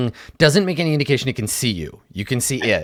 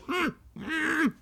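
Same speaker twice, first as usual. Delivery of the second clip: high muffled mm through a gag; gagged; 13 dB quieter than usual. Retold or imitated. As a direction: hum it as if gagged, mouth stuffed. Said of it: "like, jammed into its mouth".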